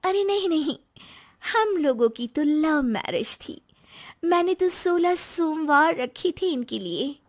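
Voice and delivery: eager, high-pitched wife correcting a guest; animated Babita character. Acted. Narrator voices Babita.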